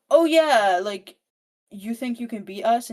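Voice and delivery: retold cocky challenge; taunting. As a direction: goad her into a fight. Brothers taunting Evie.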